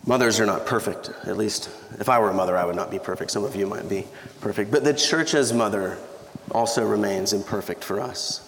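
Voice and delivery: ordinary talk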